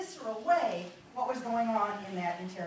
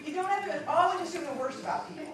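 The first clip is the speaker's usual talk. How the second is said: ordinary talk, nothing marked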